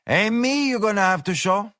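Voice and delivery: lazy, unimpressed barmaid; tough barmaid. Acted. Woman at bar voiced.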